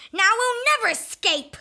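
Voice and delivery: panicked shout. Acted. Villain panics at capture.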